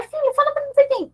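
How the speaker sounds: very high, quick coaxing voice; imitated pushy parent. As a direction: show off your child, high and hurried. Parent showing off child, acted. (mãe insistente, aguda e apressada)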